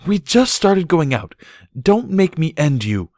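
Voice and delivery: high, loud and slow threat; threatening. A narrator's reading. Filly threatening her new boyfriend. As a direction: threaten him through the words. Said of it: "Sparkler threatened".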